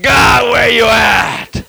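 loud, drawn-out street-style shout; re-enacted confrontational yell. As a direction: holler impatiently up at God.

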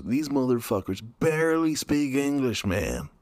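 imitated incredulous podcaster; Joe Rogan. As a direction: stress "barely" in mock disbelief. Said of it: "hear about Joe Rogan saying".

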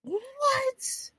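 very high breathy gasp-word; shocked what. Retold or imitated. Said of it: "I was like".

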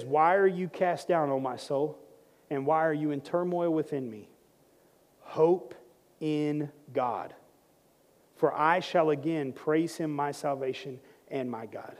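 low, soft, deliberate recitation; quiet scripture quote. Acted. Pastor quotes David to the church, quiet measured recitation. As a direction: recite it gently, with pauses. Quotes verse before commanding it.

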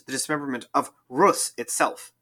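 normal talking voice

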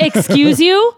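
high, loud, laughing indignation; playfully outraged rising question; mock offence. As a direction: act affronted, voice rising at the end. Repeats mock offence while laughing.

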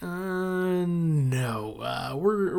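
long drawn-out, hesitant mock "uh, no"; retold reluctant refusal. Friends declining the gunman's invitation, awkwardly hesitant imitation.

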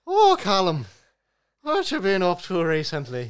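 teasing Callum in a mock Irish accent. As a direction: ham up an Irish lilt at Callum. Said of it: "spoke in an Irish accent".

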